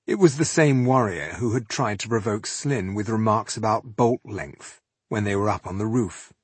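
plain reading voice

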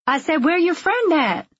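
high, pressing repeat of a question; sassy busybody who wants an answer; insistent nosy woman. A narrator's reading. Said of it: "the woman repeated".

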